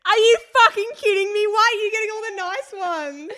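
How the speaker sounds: high, loud mock outrage. Real, not acted. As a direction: protest loudly and fast. Outraged at unfair drinks.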